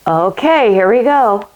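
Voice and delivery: ordinary talk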